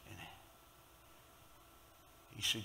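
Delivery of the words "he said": usual speaking voice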